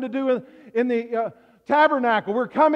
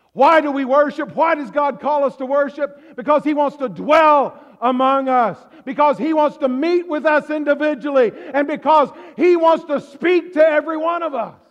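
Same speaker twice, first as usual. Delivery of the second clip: rising, louder preacher's call; fiery sermon voice building to a shout; full-force preaching; 4 semitones higher, 6 dB louder than usual. Acted. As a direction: drive it home to the congregation.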